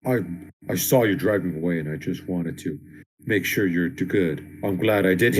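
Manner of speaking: low, halting action-star impression; The Rock. Co-host pictures Cliffjumper voiced by a wrestler, low impression. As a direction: drop the pitch well down and take it haltingly. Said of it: "let's picture The Rock's voice".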